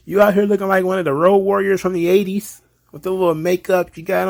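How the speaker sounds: high-pitched playful hype; teasing approval. Acted. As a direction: tease a friend with mock admiration. Acted teasing approval of Ronda.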